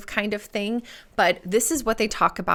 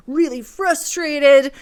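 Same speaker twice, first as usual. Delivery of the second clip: bro voice; 6 semitones higher, 7 dB louder than usual. Acted. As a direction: do a goofy frustrated bro. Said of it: "Why do I do the bro voice".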